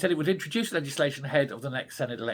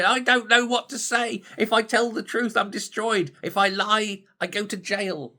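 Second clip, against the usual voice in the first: mock panic; 8 semitones higher than usual. Politicians trapped between truth and jail, in mock panic. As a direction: ham up a cornered politician panicking. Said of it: "run around like headless chickens going".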